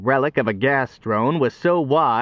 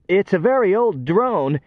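higher, rattled foreman; chattering nervously; 7 semitones higher than usual. Acted. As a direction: babble it out, wits crumbling. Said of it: "chattered the foreman".

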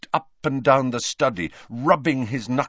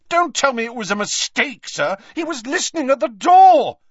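high shout building louder; furious interruption; 8 dB louder than usual. Acted. Harry cutting off Dumbledore's excuse, furious shout. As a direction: cut in and yell the accusation.

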